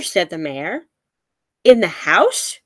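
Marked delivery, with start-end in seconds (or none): none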